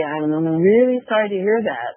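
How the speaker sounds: ordinary talk, nothing marked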